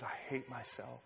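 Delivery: softly voiced confession of a woman breaking down; retold breakdown. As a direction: drop very quiet, as if barely holding back tears. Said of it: "she starts to break down".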